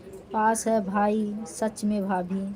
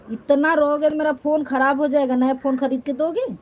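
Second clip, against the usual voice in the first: mock scolding; 5 semitones higher, 5 dB louder, faster than usual. Real, not acted. Scolding crying viewers.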